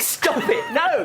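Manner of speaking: high, laughing squeal of protest; breathless giggling protest; laughing shriek. Acted. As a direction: shriek through laughter while wrestling. Play fight, pinned down.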